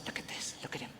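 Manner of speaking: hushed aside to the crowd; whisper. Real, not acted. Host whispering to the audience about a droid walker. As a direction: lean in and whisper look at this. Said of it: "aside whisper to audience".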